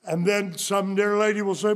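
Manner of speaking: ordinary delivery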